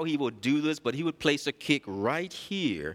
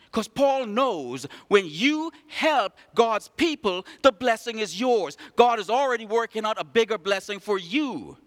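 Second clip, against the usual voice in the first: raised, ringing pulpit voice; full-force preaching; 7 semitones higher, 4 dB louder than usual. Acted. Pastor preaching to the church, raised and ringing. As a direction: preach it out to the congregation.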